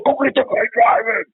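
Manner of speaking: high, loud yelled command; shouted order. Retold or imitated. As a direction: yell at the rookie to chase the driver. Partner shouting order at rookie.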